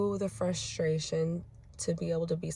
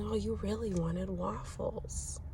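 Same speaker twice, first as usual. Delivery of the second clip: gentle empathy; 6 dB quieter than usual. Retold or imitated. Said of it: "I said".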